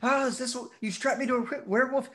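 high hammed-up panic; freaking out. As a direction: play a flustered soldier losing it. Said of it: "they're all freaking out about".

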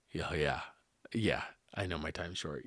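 very low, soft reply; subdued patient. Retold or imitated. Hospital patient agreeing his time is short, subdued. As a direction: answer faintly, pitch sunk down. Voices the ailing patient's answer.

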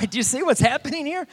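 high, loud disbelieving question; incredulous calling. Retold or imitated. Preacher to his clueless buddy, loud and incredulous. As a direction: holler it at a clueless friend.

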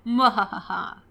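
playful villain cackle read from a comment; evil laugh. Acted. Host reading out the first comment, hammed-up evil laugh. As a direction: give a mock wicked laugh. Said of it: "Very evil first comment".

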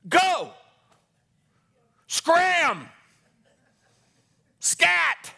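very high, loud barked commands; fierce shout driving the devil off; shouted dismissal. Acted. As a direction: blast each word out at the devil. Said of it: "I got a word for you".

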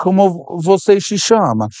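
lightly voiced little kid; little kid Portuguese. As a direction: ask it like a street kid. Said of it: "I sound like a little kid".

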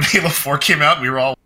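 ordinary delivery

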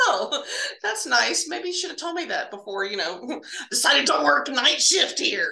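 mock-cheerful complaint through a laugh; sarcastic. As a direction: lab tech teasing the pathologists, sarcastic and laughing. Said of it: "I was like".

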